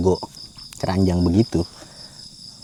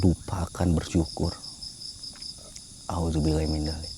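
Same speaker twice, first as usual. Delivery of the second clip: prayer; 4 semitones lower, 10 dB quieter than usual. Real, not acted. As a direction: murmur a humble prayer. Quiet prayer at midnight.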